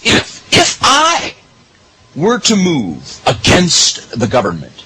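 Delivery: if, if I were stretched slowly and high; slow, pointed if I were; emphasized hypothetical. Real, not acted. Host stresses that he speaks only hypothetically. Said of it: "and I want to emphasize".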